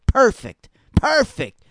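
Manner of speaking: light up at the idea, twice; real excitement over the perfect feud; excited. Real, not acted. Excited about a dream feud.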